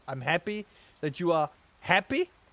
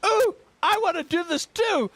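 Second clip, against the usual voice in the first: put-on high squeaky little kid voice; high, loud little-kid squeal; toddler voice; 12 semitones higher, 5 dB louder than usual. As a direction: imitate an excited toddler. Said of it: "Kenna goes".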